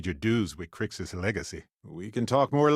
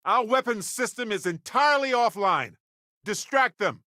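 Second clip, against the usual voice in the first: urgent combat alert; 12 semitones higher than usual. Acted. Ship in combat, urgent orders.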